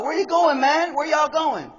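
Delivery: high-pitched attempt at a Texan accent; badly faked Texan neighbor. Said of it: "Tried my Texan accent there".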